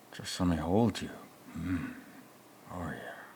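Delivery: soft creepy coo fading to a whisper; hammed-up creepy comfort into a whisper; mock tender. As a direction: lean in close and coo.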